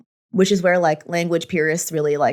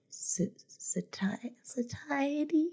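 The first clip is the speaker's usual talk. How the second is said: unsure whisper, searching for the word; 7 semitones higher, 15 dB quieter, much slower than usual. Real, not acted. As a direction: whisper it, half-guessing. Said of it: "what's the word".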